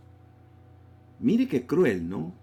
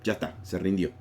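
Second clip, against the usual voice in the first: normal talking voice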